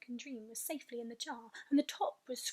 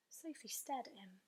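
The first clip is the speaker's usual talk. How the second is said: ordinary delivery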